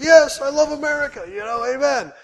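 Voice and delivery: a burst of mock delight; mock patriotic cheer. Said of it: "And I was like".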